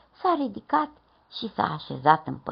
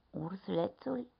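plain reading voice

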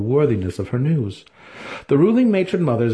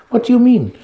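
much higher, louder demand; sharp matron question; 14 semitones higher, 7 dB louder than usual. Acted. Santa Fe demands Alton explain, high and loud. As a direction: snap the question at him.

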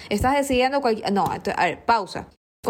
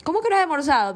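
incrédula, voz cada vez más aguda; incredulidad; 4 semitones higher than usual. Retold or imitated. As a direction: como si no pudiera creer lo que oye. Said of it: "Yo casi me voy para atrás". (sorpresa incrédula subiendo de tono)

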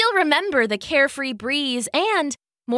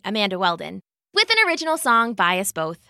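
usual speaking voice

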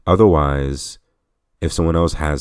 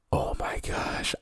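whispered exasperation; 9 dB quieter, slower than usual. Real, not acted. Frustrated at annoying boss fight.

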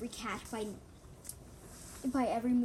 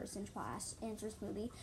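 ordinary delivery

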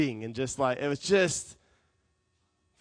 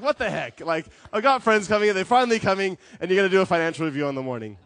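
imitating an indignant friend, loud and higher; acted-out exasperation, voice raised; exasperated complaint; 5 semitones higher, 8 dB louder than usual. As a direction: protest in mock disbelief. Acts out Sam's exasperated complaint.

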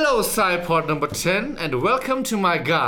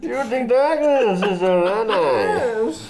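dramatic villain voice; 6 semitones higher, 4 dB louder than usual. Pretend.

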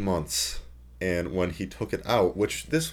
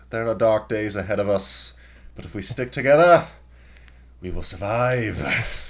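rising grand speech, louder, mocking; mock dramatic speech; 6 dB louder than usual. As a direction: preach it like a self-important politician, louder and louder. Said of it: "And it's like".

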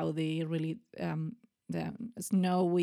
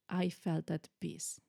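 normal talking voice

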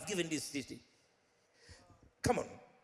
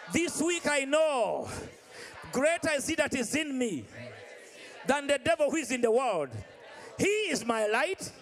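preaching at full force; 5 semitones higher, 4 dB louder than usual. Real, not acted. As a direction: rouse the whole church, hammering each phrase. Rousing the congregation.

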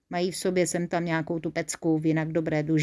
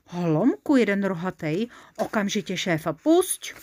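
ordinary delivery

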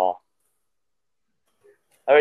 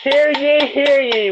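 booming high proclamation; town crier; 6 dB louder, slower than usual. Pretend. Opening the podcast like a crier.

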